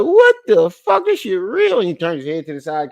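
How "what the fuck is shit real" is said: stretched-out, much higher cry of shock; reliving the moment he opened it; stunned disbelief. Retold or imitated. Opening the clip, he reacts in slow, high disbelief. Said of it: "was like".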